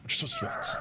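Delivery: conspiratorial whisper. Acted. Streamer sneaking a secret to chat, whispered.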